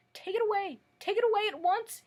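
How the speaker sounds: high, loud panicked shout. Acted. The king jumps from the throne and shouts in panic, high and loud. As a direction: scream it at the hen in panic. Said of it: "he shouted".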